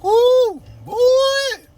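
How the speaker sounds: very high, loud woo; excited fan shriek; fanboy squeal. Pretend.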